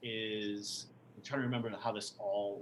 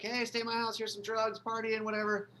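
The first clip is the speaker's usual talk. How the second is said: mock party host; 9 semitones higher than usual. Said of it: "people are like".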